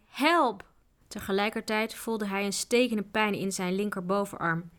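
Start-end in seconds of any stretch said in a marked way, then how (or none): 0.1-0.7 higher, urgent called-out cry; cry for help. A narrator's reading. Tjuri calls for help while fighting off attackers, urgent cry. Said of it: "zelf riep hij".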